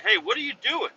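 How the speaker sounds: high mock coworker voice; imitating a coworker. Coworker runs up asking what he's doing, high mock voice. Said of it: "He's like".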